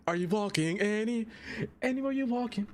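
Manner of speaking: mock yell. Streamer mocking the singer yelling the misheard lyric, mock yell. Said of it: "Just yelling it".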